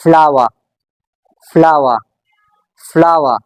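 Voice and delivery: "फ्लावर" in British English, final r silent; British non-rhotic "फ्लावर" with a falling tune; British accent. Shown as a demonstration. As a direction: say "फ्लावर" the British way, ending on an open "flah-wuh". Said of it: "British accent में".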